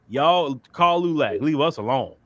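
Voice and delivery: ordinary talk, nothing marked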